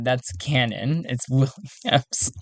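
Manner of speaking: ordinary talk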